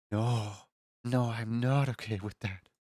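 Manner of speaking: put-on mild pouty child refusal; sulky kid. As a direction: grumble it like a disappointed kid. Son refusing apple cake.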